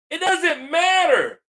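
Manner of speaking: real angry rebuttal; angry shout. Real, not acted. Rebutting the critic angrily.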